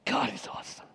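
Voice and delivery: breathless awed whisper; whispered praise. Pretend. Preacher echoing the believer's praise, whispered. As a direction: let it fall to a whisper.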